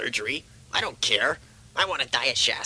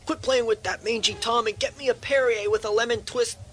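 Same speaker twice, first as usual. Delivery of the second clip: cranky bossy yell; 7 semitones higher than usual. Acted. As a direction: order the staff about impatiently. Patient yells orders at nurse.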